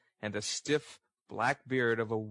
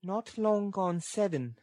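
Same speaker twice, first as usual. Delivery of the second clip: put-on high, slow girl's voice; high-pitched character voice for the girl; Nancy's voice; 8 semitones higher than usual. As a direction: voice a weary girl in a high register. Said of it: "the voice in which she replied".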